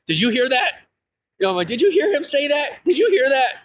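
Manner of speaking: turning to siblings, unable to believe it; to siblings at the hospital bed, astonished and high; excited disbelief. Retold or imitated. Stunned, asking siblings in hospital.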